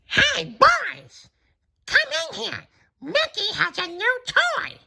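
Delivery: very high duck voice, calling; Donald calling out. A narrator's reading. Donald calling his nephews inside, high duck call. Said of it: "Donald called out".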